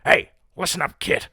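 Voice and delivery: pep up your fighter between rounds; gruff boxing coach. Pretend. Boxing coach in the corner to his fighter, gruff and loud. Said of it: "like a boxing coach in the corner".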